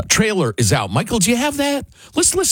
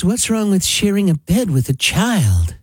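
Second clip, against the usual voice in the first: higher-pitched mock innocent voice; 6 semitones higher than usual. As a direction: lightly imitate a naive pop star. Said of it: "most famous one was [name]".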